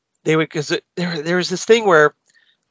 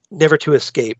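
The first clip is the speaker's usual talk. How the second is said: normal talking voice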